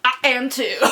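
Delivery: high, loud mock-regal reply; playful high loud king; loud goofy king. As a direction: answer back like a goofy king. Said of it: "the King of Swords is like".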